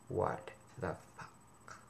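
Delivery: breathy whispered what the fuck, slow; hushed disbelief under the breath; whispered aside. Real, not acted. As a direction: drop to a stunned whisper.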